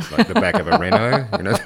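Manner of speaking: words breaking into high laughter; laughing. Real, not acted. [name] laughing at his own bizarre storm thought. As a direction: giggle through the punchline.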